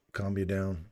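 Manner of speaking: normal talking voice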